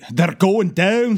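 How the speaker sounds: loud, drawn-out Scottish cry of alarm; Scotty impression. Scotty radioing Leader One, mock-panicked Scottish wail. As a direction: wail it high and slow in a mock Scottish accent. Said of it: "And Scotty radios into Leader One".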